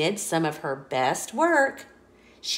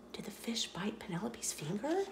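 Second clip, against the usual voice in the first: hushed breathy question; suspenseful whisper; 14 dB quieter, faster than usual. A narrator's reading. Narrator builds suspense.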